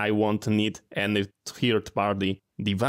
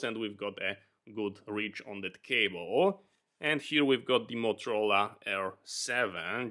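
quieter, through a cheap lavalier; new mic sound; 8 dB quieter than usual. Real, not acted. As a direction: voice picked up by a clip-on mic. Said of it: "switch the audio to our new mic".

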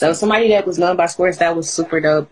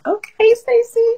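excited; slower than usual. Retold or imitated. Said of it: "I was like".

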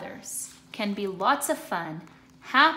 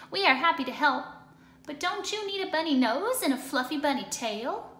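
chicken voice; 6 semitones higher, faster than usual. Acted. Chicken answering Pete, high friendly hen voice. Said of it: "the chicken said".

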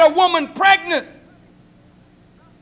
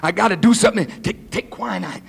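low, rushed, stammering worry; desperate muttering; 7 semitones lower, faster than usual. Acted. Pregnant girl deciding she's gotta do something, muttered desperation. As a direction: stumble through it under your breath. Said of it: "Then you say".

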